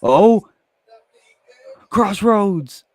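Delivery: loud, high excited call; excited shout. Real, not acted. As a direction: yell the move name.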